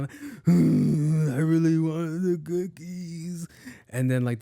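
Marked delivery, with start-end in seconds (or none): 0.4-3.5 put-on higher, slow whimpering child voice; mock crying kid. A little kid denied cookies, whimpering slowly. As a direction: whine it like a kid about to cry. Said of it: "one started crying".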